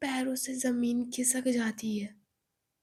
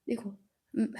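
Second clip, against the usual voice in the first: usual speaking voice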